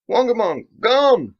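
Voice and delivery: very high startled shout; panicked warning cry; alarmed yelp. Acted.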